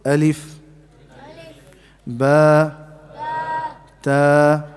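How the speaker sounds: alif, ba, ta each said alone with a pause; recited letters. Shown as a demonstration. Teacher reciting the alphabet for students to copy. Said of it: "the first one is".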